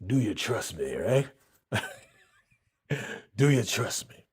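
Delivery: low, soft come-on voice; low, soft seductive pickup line; smooth-talking man. Retold or imitated. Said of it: "he's like".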